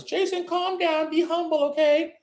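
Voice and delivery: a lightly voiced tribe member urging calm; tribe member pleading. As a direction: play a worried fan telling him to calm down. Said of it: "one of my tribe members".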